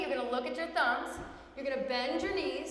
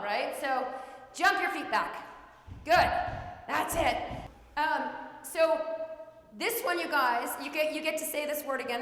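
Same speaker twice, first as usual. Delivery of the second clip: normal talking voice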